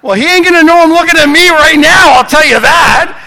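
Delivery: high, very loud, fast rant; shouting as if mid-argument; heated altercation. Retold or imitated. Pastor retelling his blow-up with the ticket guy, heated altercation. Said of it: "we have this altercation".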